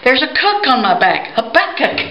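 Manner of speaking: high, excited cry of surprise; high character voice. Acted. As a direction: voice jumps way up, pitched high and lively. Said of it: "he says".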